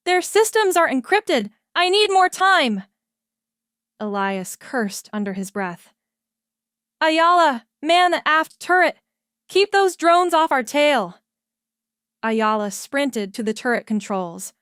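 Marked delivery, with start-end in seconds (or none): 0.1-2.8 very high, loud stressed shout. A narrator's reading. Rami shouting back to captain under fire, loud. 7.0-11.2 higher, loud order; commanding shout. A narrator's reading. Captain ordering Ayala to the turret, loud and high.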